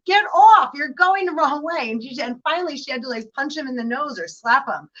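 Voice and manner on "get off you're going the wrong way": high, loud hammed-up yell; mock yell. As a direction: shove him off with a squeal. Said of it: "And she's like".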